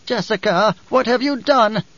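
put-on high-pitched old woman demanding an answer. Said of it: "the old woman demanded".

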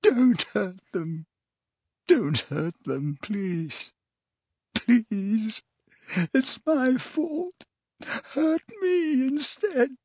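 slow, high, tearful begging; drawn-out anguished whimper; moaning. A narrator's reading. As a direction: moan it weakly, pleading for others. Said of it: "as he moaned".

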